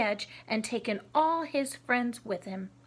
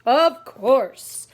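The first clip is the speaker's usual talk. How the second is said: loud booming answer; loud tiger roar; 12 dB louder than usual. Acted.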